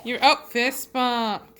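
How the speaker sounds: usual speaking voice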